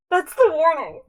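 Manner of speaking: high, loud disbelief; incredulous outburst. Real, not acted. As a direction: burst out in amused disbelief. Incredulous repeat of the warning.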